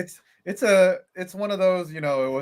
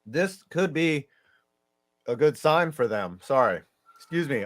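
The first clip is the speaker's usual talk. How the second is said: ordinary delivery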